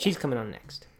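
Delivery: quick hushed aside; whisper. Real, not acted. Aside while child present.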